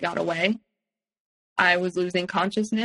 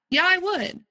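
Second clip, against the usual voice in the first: defiant reply; 11 semitones higher than usual. Retold or imitated. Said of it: "And I'm like".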